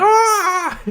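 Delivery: high mock scream, half laughing; a comic shriek of pain. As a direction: squeal like a stabbed victim, played for laughs. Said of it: "She's like".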